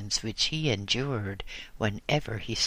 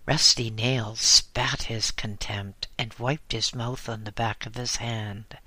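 ordinary delivery, nothing marked

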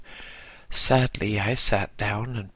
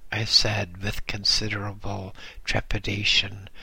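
ordinary delivery, nothing marked

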